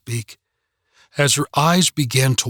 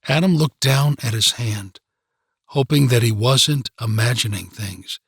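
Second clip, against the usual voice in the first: plain reading voice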